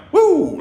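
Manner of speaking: very high, loud whoop; acted-out yelp of alarm; startled whoop. Visitor leaping from bug-covered chair, startled whoop.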